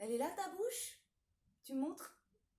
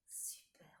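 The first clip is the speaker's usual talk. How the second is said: whisper; 9 semitones lower, 17 dB quieter than usual. Acted. After whisper section.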